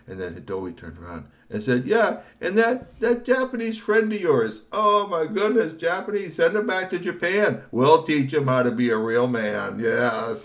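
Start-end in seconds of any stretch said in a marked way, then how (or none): 1.8-10.4 high, loud, slow mocking sneer; sneering thug voice. Hidoi mocking Tony's Japanese friend, sneering. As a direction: jeer at the foreign friend.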